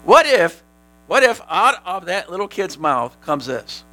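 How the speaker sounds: usual speaking voice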